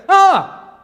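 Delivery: loud, high "ah" of discovery; hammed-up cry of dismay; mock shocked cry. Preacher imitating the driver seeing the damage, mock shock.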